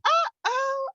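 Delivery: very high, loud mock "oh"; feigned understanding. Nodding along to accents she can't follow, feigned understanding. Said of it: "I was doing a lot of that".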